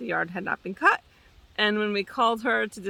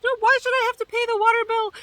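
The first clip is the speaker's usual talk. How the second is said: high, louder indignant mock whine; mimicking a furious tenant, pitched way up; mad tenant; 12 semitones higher, 3 dB louder than usual. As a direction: squeal it out like an outraged tenant. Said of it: "And she's like".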